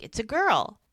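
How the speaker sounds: retold joyful announcement; excited announcement. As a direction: announce it with a pitch jump on "girl". Husband announces newborn in retold scene.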